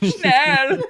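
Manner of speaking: loud, very high German command; mock German accent. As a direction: cry it out in hammed-up German. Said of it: "my German accent always goes into the sex thing".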